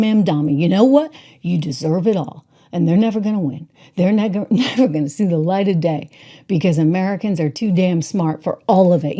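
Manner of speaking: ordinary talk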